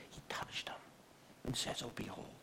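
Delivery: very soft, near-whispered awe; hushed. Acted. Preacher telling how Jesus touched the leper, hushed. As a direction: let it drop to a tender hush.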